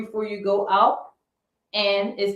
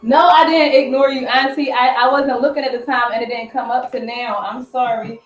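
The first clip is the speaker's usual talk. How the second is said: calling out; 2 semitones higher, 7 dB louder than usual. Real, not acted. Apologizing loudly to auntie off-camera.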